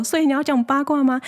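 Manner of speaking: gleefully expecting drama, fast and high; eager onlooker. Retold or imitated. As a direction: ask it like you're here for the show. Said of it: "也是一种看好戏说".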